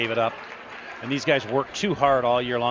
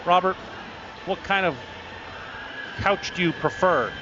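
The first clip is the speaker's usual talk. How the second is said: twang; 4 semitones higher, 3 dB quieter than usual. Retold or imitated. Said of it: "a, a twang to him".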